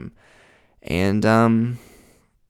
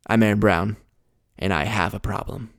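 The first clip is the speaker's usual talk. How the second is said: ordinary delivery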